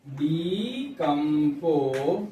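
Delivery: slow, stretched syllables while writing; drawn-out syllables. Real, not acted. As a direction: sound it out syllable by syllable. Sounding out word while writing.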